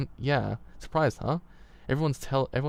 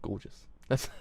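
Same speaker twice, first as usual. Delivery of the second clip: ordinary delivery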